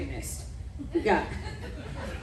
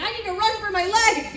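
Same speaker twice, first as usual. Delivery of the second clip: mock panic; 10 semitones higher than usual. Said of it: "They're like".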